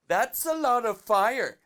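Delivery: high, loud, stretched-out cry; hammed-up exclamation. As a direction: call it out slow and loud, hammed up. Acts out his unfinished exclamation.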